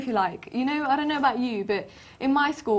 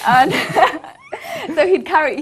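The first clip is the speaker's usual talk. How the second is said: laughing; 5 semitones higher, 7 dB louder than usual. Real, not acted. Joking the armor outweighs her, laughing. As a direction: burst of laughter running into the words.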